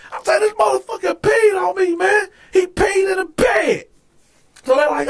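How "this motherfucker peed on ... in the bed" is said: very high, exasperated protest; outraged complaint. Retold or imitated. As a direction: re-enact frantic indignation to the guards. Retold complaint to guards.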